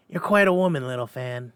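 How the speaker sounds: put-on boy exclaiming. A narrator's reading. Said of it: "exclaimed the boy".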